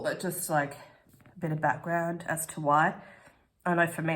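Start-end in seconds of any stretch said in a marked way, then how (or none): none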